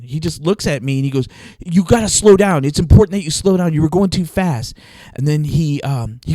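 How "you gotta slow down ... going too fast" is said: loud, urgent police scolding; yelling cop. Retold or imitated. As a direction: lecture the driver like a hot-headed cop. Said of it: "he yells at us".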